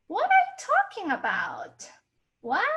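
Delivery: high-pitched confused questioning; shocked disbelief. Shown as a demonstration. Teacher acts out second tone for learners, shocked and confused. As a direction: ask it high, as if baffled. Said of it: "you're in disbelief".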